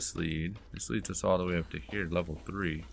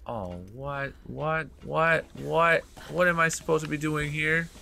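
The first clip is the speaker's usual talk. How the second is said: repeated higher-pitched incredulous questions; baffled disbelief; 6 semitones higher than usual. Real, not acted. Player lost at the game's instruction, baffled disbelief.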